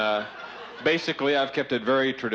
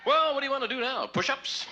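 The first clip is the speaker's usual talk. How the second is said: much higher silly voice; squeaky character voice. Acted. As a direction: pipe up in a cartoon voice. High put-on voice.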